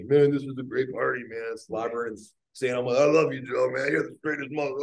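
put-on loud, high slurry drunk buddy; loud, high mock drunk gushing; drunk partygoer. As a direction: ham up a sloppy drunk guest. Drunk partygoers slobbering praise.

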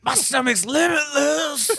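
high, loud, slow mock wail; hammed-up movie cry. Riffing on a Limitless food sequel, hammed-up cry. Shouted punchline in movie riff.

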